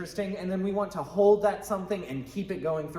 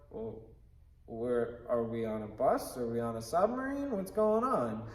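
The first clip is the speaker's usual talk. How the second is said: quiet, halting puzzlement rising into questions; hesitant, baffled questioning; confused audience; 5 semitones lower, 3 dB quieter than usual. Acted. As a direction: wonder aloud, lost and unsure. Acted confused audience reaction.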